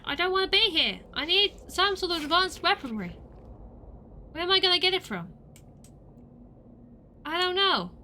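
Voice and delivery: loud, high whiny lament; frantic high complaint; panicked yelling. Real, not acted. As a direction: moan it loudly, at the end of your rope. Player panicking over quest.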